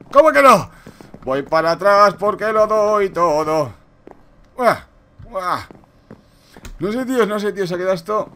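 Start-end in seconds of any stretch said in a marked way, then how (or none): 0.1-0.7 loud and high in disbelief; indignant shout. Real, not acted. 1.2-5.7 being chased. Real, not acted. Running flat out, gasping.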